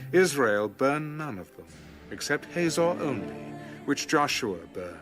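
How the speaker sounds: ordinary delivery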